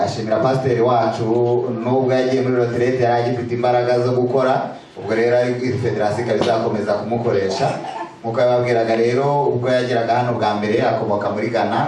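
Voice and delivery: ordinary talk, nothing marked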